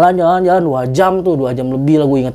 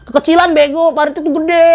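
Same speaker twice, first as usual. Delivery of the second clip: much higher, scolding leader; bossy scolding; 10 semitones higher than usual. Retold or imitated. Group leader scolding him for the tiny ditch, high bossy scold. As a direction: berate the small ditch.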